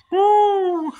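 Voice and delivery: loud high woo; high falsetto whoop. Pretend. Vocal imitation of guitar.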